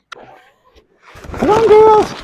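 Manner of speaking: high sing-song call to hens; chicken calling voice. Real, not acted.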